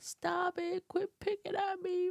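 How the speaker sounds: put-on high, whimpering little-kid plea; whiny crying kid. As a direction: beg like a crying little sister. Crying child begging sister.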